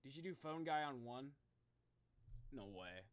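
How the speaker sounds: usual speaking voice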